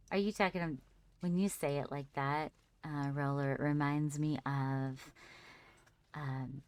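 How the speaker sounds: ordinary delivery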